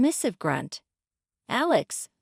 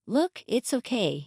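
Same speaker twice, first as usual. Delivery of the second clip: usual speaking voice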